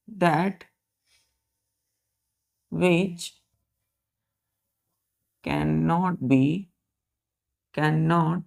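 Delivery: slow, drawn-out dictation for writing. Shown as a demonstration. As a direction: dictate each word slowly for the class. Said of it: "teacher dictating slowly". (धीमे-धीमे बोलकर लिखवाना)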